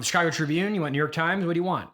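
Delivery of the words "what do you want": ordinary talk, nothing marked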